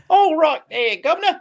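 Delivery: high, hammed-up Cockney greeting; mock British accent. Co-host riffs on Danger Mouse, mock British accent.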